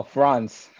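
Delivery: Franz with a French-style open a; correcting the name. Shown as a demonstration. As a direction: Franz said the Haitian way, stressed and clear. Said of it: "am I saying it right?".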